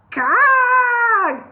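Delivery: high, loud cawing shriek; bird shriek. Acted. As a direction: screech like a startled bird. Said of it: "shrieked the bird".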